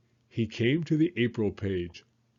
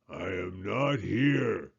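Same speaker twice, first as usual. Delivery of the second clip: grumpy toad; 3 semitones lower, slower than usual. Acted. Grumpy toad hides in bed.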